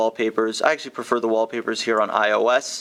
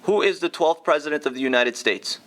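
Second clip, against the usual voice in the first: repeating to voice assistant; 5 semitones higher than usual. Real, not acted. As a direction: whisper the query clearly to the phone. Asking voice assistant.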